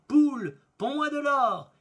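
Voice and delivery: loud, high magic order; ringing incantation to a hen; called-out command. A narrator's reading. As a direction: call out the spell, stretching the words. Magic command acted in tale.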